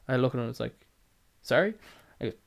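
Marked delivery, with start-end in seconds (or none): 1.4-1.7 high, rising baffled sorry. Retold or imitated. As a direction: ask it like you can't believe your ears. Confused reply to customer's noise.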